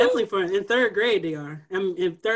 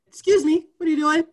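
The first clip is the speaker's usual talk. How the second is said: higher, louder scolding teacher voice; stern teacher; 8 semitones higher, 8 dB louder than usual. Retold or imitated. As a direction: call out a student firmly. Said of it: "I said".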